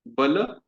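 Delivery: बल said crisp and short; plain short vowels in बल. Shown as a demonstration. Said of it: "तो इसलिए".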